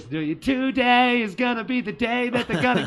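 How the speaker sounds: high, nasal whiny singing. Retold or imitated. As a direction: whine it like a try-hard punk singer. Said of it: "put on my whiny ass voice".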